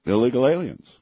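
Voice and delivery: ordinary talk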